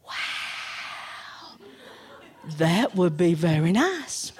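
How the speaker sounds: low, breathy wow, then deep bear voice; deep, gullible bear; awed bear. Acted. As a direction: marvel like a slow-witted bear. Bear falls for the offer.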